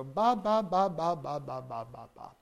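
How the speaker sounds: soft babbled ba syllables, fading; quiet baby babble; baby babbling ba. Shown as a demonstration. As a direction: babble like an infant trying out lips. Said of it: "discovers, say, the, you know, uh, labial stops".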